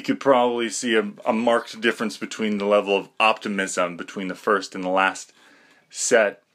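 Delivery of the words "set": normal talking voice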